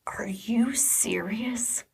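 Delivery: slow, hushed disbelief; husband whispering in disbelief at the call; stunned whisper. Retold or imitated. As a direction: breathe it out, stunned. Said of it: "he goes".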